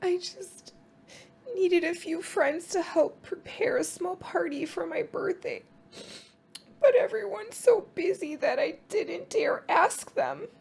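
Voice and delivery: a timid hedgehog admitting she's lonely; high, sniffing little hedgehog voice; sniffly little Hedgehog. Acted. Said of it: "Hedgehog stops and sniffs".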